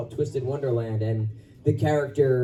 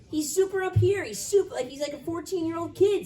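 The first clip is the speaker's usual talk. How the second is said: put-on much higher voice of a teen boy; high kid voice. Shown as a demonstration. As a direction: pitch way up like a fourteen-year-old kid. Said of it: "that voice for me is very high in my register".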